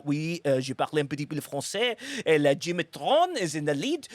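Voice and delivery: hammed-up French commentator; mock French accent. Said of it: "you switch to, uh, French".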